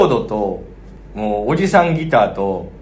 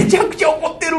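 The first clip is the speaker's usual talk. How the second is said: alarmed. Retold or imitated. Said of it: "そう".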